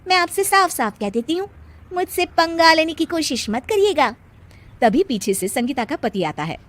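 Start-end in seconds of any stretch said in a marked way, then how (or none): 0.1-4.2 high, sassy warning; defiant. Acted. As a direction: lay down the law cheekily. Bride warning mother-in-law. (ऊँची आवाज़ में चुनौती भरी चेतावनी)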